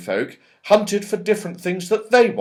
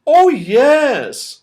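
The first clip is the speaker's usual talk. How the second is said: much higher, louder fairy voice; bright Silky; 11 semitones higher, 8 dB louder than usual. Acted. Silky reassuring Joe, high and eager. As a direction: chirp it out eagerly. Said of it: "said Silky".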